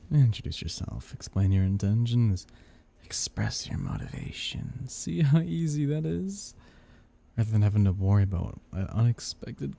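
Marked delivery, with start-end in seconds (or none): 3.0-4.9 low breathy aside; sinister whisper. Acted. 4.9-6.5 smug. Acted. Devil smug.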